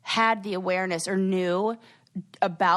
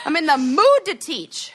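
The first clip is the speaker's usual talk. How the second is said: loud, high-pitched declaration; worked up; 7 semitones higher, 7 dB louder than usual. Real, not acted. Announcing she is ready to teach, worked up. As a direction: belt it out, raring to go. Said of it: "when I'm this worked up".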